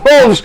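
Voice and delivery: coughing out Wolves; fake cough. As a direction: disguise Wolves as a cough, loud. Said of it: "I, I coughed".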